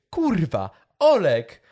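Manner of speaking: loud mock yell, one word at a time; imitated shout. Changu standing up to yell at Olek, imitated shouting. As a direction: shout the curse, pause, then bellow the name. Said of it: "Changu wstaje i krzyczy".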